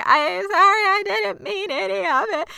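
high, loud crying plea; crying apology. Retold or imitated. Misty begging the bird to come back, crying. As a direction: sob it out as a breaking apology. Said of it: "Misty's crying".

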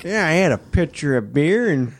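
high-pitched imitation of a tipsy boast; teasing high-voiced impersonation; imitating wife. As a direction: mimic your wife, pitched well up. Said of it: "and you're like".

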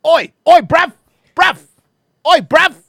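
very high, very loud short yelps; mock British yob. Host clowning with British slang, high loud mock yob calls. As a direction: squawk it like a cartoon British lad.

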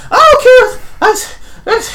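high, loud outburst; enraged woman transforming. Acted. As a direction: play her lightly as a raging woman. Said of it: "she's full bitch arm mode".

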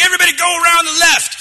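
high, loud, fast command; barked order. Retold or imitated. Player ordering squad, high fast shout. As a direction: order the squad around the left. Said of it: "I'm like".

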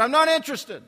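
high, loud irritated refusal; angry voice. Retold or imitated. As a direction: imitate an angry man shouting through a door. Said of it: "heard this angry voice said".

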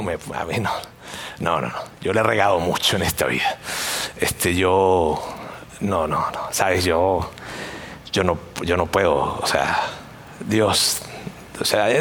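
low, halting, hesitant excuse; persona que duda. Acted. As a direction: falter in a low voice, stopping and restarting. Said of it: "ese tipo de persona que dice". (persona dudosa, voz baja y titubeante)

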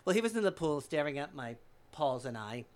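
usual speaking voice

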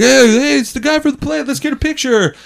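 high-pitched mock fan clamour; excited fan. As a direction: call out high, imitating eager fans. Fans stopping the actor for photos.

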